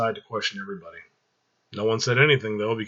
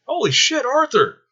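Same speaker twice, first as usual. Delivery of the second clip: much higher, thrilled greeting; excited; 5 dB louder than usual. Acted. Michael greets his old friend Arthur, thrilled and much higher. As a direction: burst out at seeing an old friend. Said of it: "looked over in excitement".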